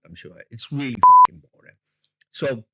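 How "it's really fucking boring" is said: fast, loud emphatic blast; loud outburst. Real, not acted. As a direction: blurt it out loud and quick. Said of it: "They'll beep that out".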